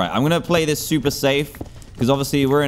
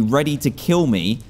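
normal talking voice

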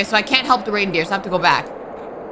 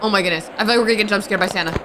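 normal talking voice

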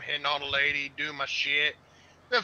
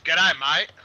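mock Australian; 5 dB louder than usual. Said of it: "The only thing Australian I know is".

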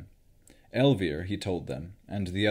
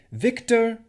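sharp, much higher and louder scold; 8 dB louder than usual. A narrator's reading. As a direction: snap his name to stop him. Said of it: "Rowan scolded".

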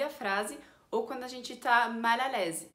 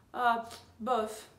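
slow, unimpressed sigh into bof; a listless, can't-be-bothered bof; bored disinterest; 3 dB louder, slower than usual. Shown as a demonstration. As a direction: shrug it off with a dismissive pff. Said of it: "fazem uma cara meio pf, assim, de desinteresse".

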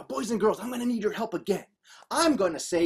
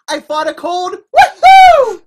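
loud, high, whooping finish; celebrate, leaping up to a whoop; triumphant cheer; 10 semitones higher, 17 dB louder than usual. Acted. Child who fought a cold, jubilant shout.

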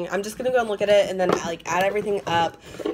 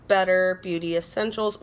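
ordinary talk, nothing marked

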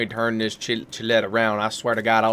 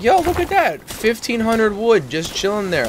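excited; 10 semitones higher than usual. Pretend. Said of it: "Just walk up and be like".

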